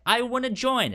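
put-on high and loud, like an eager kid; mock eager ask. Lucy asking to join the guild, mock eager.

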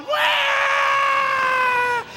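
put-on sudden high crying wail like a toddler; mock child wail. Pastor imitating a child bursting into tears, high wail. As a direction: burst into a fake kid's bawl. Kids' laughter turns to tears.

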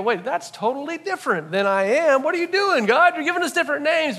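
high, fast protesting voice; mock-bewildered congregation member; confused listener. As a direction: squeak out the objection like a baffled listener. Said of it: "And we go".

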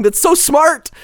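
high, bright gushing praise; excited. Real, not acted. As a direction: gush about how smart it is.

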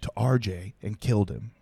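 ordinary talk

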